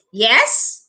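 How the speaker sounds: "yes" swooping up steeply at the end; upward, questioning "yes?"; rising intonation. Shown as a demonstration. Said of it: "raise the intonation with yes".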